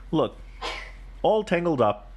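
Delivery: ordinary delivery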